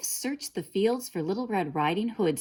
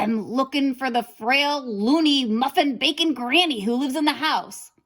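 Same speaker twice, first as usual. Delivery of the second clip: flustered wolf; 7 semitones higher, 7 dB louder than usual. A narrator's reading. Caught wolf pleads.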